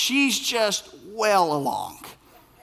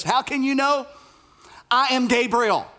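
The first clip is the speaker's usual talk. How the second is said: sarcastic angel; 6 semitones higher than usual. Acted. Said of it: "witty, sarcastic angel".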